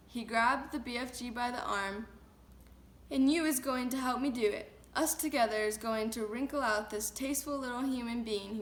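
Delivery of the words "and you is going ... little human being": ordinary delivery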